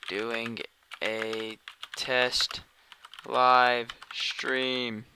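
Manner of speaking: drawn-out words with gaps between them; slow, word-by-word. Real, not acted. Testing a live stream by typed message, slow word-by-word. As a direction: say each word slowly, as if typing it.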